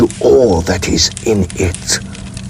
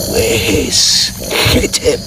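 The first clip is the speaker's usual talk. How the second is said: ordinary delivery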